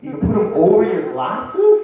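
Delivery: high-pitched, wide-eyed comic wonder; excited. Retold or imitated. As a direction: marvel loudly at a brand-new discovery. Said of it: "I was excited".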